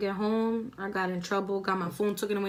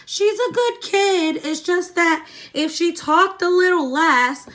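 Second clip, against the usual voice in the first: higher, louder, slow sing-song teacher voice; mock teacher praise; 9 semitones higher, 6 dB louder, slower than usual. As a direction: parody a gushing teacher telling mom. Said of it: "teachers always love to be like".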